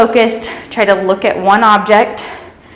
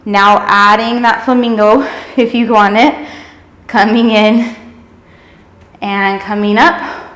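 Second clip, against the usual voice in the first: ordinary talk, nothing marked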